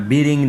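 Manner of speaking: बिरिंग with a short "i" between b and r; wrong added vowel. Shown as a demonstration. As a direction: say बिरिंग as "bi-ring". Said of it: "नहीं पढ़ना".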